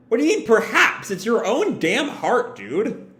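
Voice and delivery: high-pitched incredulous retort, falling off at the end; exasperated disbelief. Real, not acted. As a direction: challenge it, baffled and exasperated. Own exasperated retort to the character.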